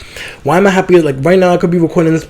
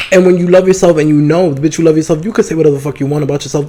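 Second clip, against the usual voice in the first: ordinary delivery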